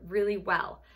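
ordinary talk, nothing marked

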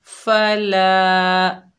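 फला with the ला stretched one alif long; फला, long ला at the end; drawn-out la. Shown as a demonstration. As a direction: say फला slowly, holding the ला. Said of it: "एक अलिफ की मिकदार खींच कर पढ़ेंगे ला".

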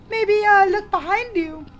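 high squeaky put-on hint; lady character voice. Lady helping host find candy, high hesitant hint. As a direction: pitch it high like a little lady. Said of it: "Lady, can you help me find candy?".

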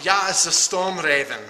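lightly put-on German accent on Ja. Unboxing a gunship, in a mock German accent. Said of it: "to talk in an accent".

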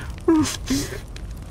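high unvoiced relieved laugh; breathy laugh. Real, not acted. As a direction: laugh out the fright. Laughing after scare.

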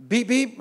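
high mock beep beep of a horn; playful horn beeps; imitated horn beeps. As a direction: toot it like a car horn.

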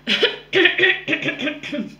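loud cough; hacking cough. Real, not acted. Sore throat while recording.

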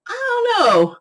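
a sudden high squeak on the words; squeaky shrug. Real, not acted. Shrugging off her coffee habit with a high squeak. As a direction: pop the line up into a tiny high voice. Joking about coffee versus tea.

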